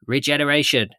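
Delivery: lightly voiced British politician; a hint of a measured party leader's speech; Keir Starmer impression. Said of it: "Was that an impression".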